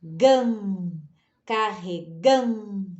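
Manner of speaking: "carregando" slowly, stretching a nasal "gan"; syllable-by-syllable "carregando", long nasal middle; nasal sound. Shown as a demonstration. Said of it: "que também dá som nasal".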